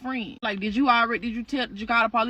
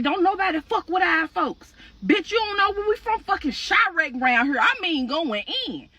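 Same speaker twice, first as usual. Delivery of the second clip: raised, hard street threat; tough boast; 7 semitones higher than usual. Retold or imitated. Sister brags about the beating, tough and raised. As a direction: brag like a hardened fighter.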